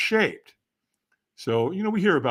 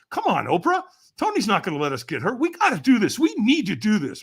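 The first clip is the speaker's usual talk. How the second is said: lightly voiced pep talk, higher; cheerleading; 6 semitones higher than usual. [name] urging Oprah to walk, mock cheerleading. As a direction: cheer her on, half-playful. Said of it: "cheerleading for Oprah".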